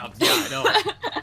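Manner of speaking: high, slow mock-smug. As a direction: drawl it with fake pride. Joking about being attractive.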